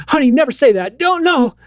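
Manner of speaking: high, urgent scolding; mock alarmed parent. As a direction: imitate a panicked parent jumping in. Imagined alarmed parent correcting daughter.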